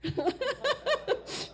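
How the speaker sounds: high amused laugh; a light self-amused laugh. Real, not acted. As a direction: laugh at the nickname. Laughs at nickname Little Pepper.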